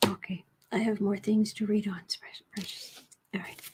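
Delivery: soft unvoiced whisper. Real, not acted. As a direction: keep it to a hushed whisper. Whispering beside the cat.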